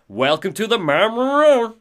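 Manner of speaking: high, loud greeting that smears into garble; glitching Rose. Acted. Storyteller voicing a diner waitress welcoming Alan, glitching out. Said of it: "it's almost like she's glitching out".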